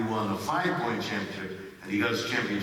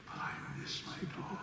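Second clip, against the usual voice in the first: hushed confession; 13 dB quieter than usual. Real, not acted. Confessing he misses his dog.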